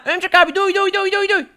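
put-on very high, frantic kid voice; little boy panicking at the alien; panicked little kid.

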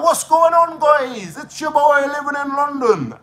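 mocking an anonymous YouTuber's cheery hello; youtuber intro. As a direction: ham up a cheesy channel intro. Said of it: "Imagine how far come on, like".

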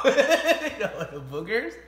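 slow, laughing question; laughing tease. Real, not acted. Laughing while teasing.

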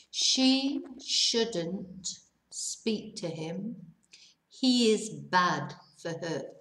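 "She shouldn't speak to him" spoken very slowly, word by word; slow reading. Shown as a demonstration. Teacher reads the example very slowly to students. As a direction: stretch each word out for learners. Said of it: "voy a leerlo, lento".

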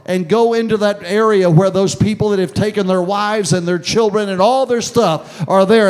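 loud, high, driving sermon delivery; full-force preaching. Acted. Preacher rousing the church, loud and high.

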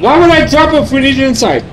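fast, loud, high protest; exasperated. Real, not acted. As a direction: argue it, disbelieving. Arguing with teammates.

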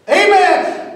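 high, loud, fervent amen; a fervent amen from the car owner; shouted amen. Retold or imitated. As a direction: cry it out like a revival. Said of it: "parking lot".

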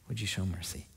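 very soft, low plea; hushed pleading. Acted. Preacher voicing the mother praying to God for mercy, hushed pleading. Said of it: "She's just pleading with God".

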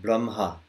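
"Brahma" pronounced brum-ha, H after M. Shown as a demonstration. Teacher answering student, "Brahma" said as brum-ha. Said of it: "as if the H was after the M".

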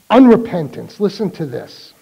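ordinary delivery

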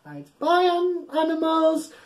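ordinary delivery

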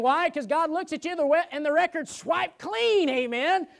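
high, driving preacher's voice; preaching at full force. Acted. Pastor preaching justification to the church, full force. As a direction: preach it hard to the room.

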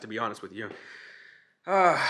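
ordinary delivery

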